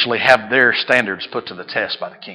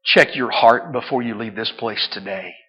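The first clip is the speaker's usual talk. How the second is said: ordinary talk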